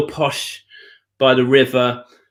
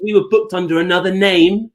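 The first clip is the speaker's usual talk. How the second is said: ordinary talk, nothing marked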